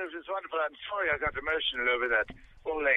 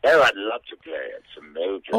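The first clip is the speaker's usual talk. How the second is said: much lower put-on character voice; mock low voice; 9 semitones lower, 3 dB louder than usual. Mole agrees to play in a low goofy voice.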